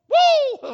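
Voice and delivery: loud, high ecstatic whoop; joyful whoop. Real, not acted. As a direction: let out a wild cry of joy. Preacher carried away.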